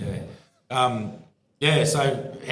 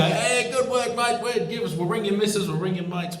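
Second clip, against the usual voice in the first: higher-pitched, lightly acted matey offer; mock friendly encouragement; 10 semitones higher than usual. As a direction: imitate cops being chummy to a good bloke. Acting out hoped-for friendly police chat.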